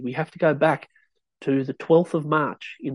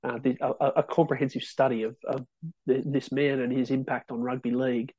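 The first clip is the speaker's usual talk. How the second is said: ordinary talk, nothing marked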